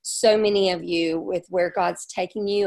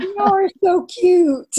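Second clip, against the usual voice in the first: high, slow and loud, cooing over it; gushing tease; 12 semitones higher, 8 dB louder, slower than usual. Real, not acted. Cohost teasing her friend for gushing about her husband, cooing high and loud. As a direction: squeal it like an adoring friend.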